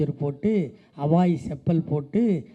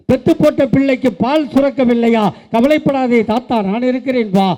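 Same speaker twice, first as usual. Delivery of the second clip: higher, louder rally-speech delivery; impassioned oratory; 6 semitones higher, 7 dB louder than usual. Real, not acted. Orator promising a grandfather leader's help to mothers, impassioned oratory. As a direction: proclaim each promise to the crowd, easing at the end.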